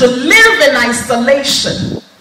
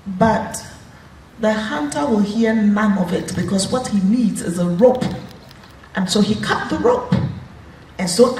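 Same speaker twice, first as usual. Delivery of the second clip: ordinary delivery, nothing marked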